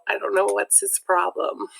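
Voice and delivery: usual speaking voice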